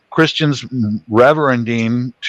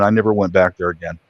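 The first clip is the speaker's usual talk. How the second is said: normal talking voice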